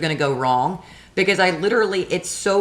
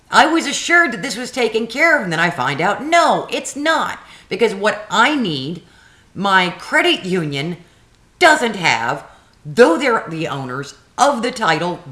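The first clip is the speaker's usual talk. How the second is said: louder, higher, exasperated ranting; raised angry voice; 4 semitones higher, 3 dB louder than usual. Retold or imitated. Venting at the DMV clerk on the phone, raised angry voice. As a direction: vent at the clerk, voice raised and cracking.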